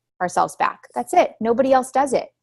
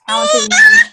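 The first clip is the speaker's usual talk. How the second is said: higher, louder and fast, driving it home; emphatic shout; 8 dB louder, faster than usual. Real, not acted. Coach shouting to her team that nobody outranks them, emphatic shout.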